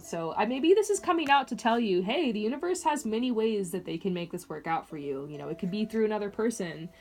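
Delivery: ordinary talk, nothing marked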